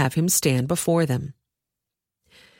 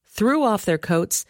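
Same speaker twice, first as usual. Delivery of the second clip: usual speaking voice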